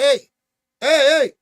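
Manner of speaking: loud call to get someone's attention. Retold or imitated. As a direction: shout hey across the building. Calling out to the guy inside.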